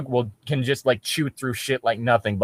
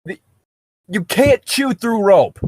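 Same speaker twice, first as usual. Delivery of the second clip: incredulous; 9 semitones higher than usual. Real, not acted. Arguing the story's logic.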